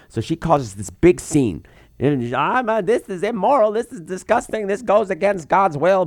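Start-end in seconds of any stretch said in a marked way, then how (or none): 2.3-6.1 high, indignant mock-outraged voice; huffing about God's will in a prissy tone; mock outraged lady. Doctor Mommy storming out of the cloning lab, mock outraged.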